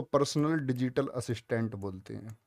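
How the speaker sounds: ordinary talk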